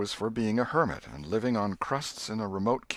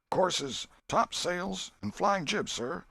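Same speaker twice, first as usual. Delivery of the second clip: higher and higher eager report; excited crew report; 11 semitones higher, slower than usual. Acted. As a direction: rattle off the sails eagerly. Crew answers, rising excitement.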